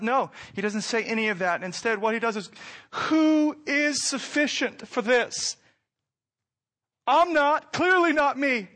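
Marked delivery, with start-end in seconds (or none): 2.9-8.7 high, loud, earnest plea; impassioned outcry. Acted. Preacher voicing Paul's humility, high and loud. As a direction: cry out your unworthiness.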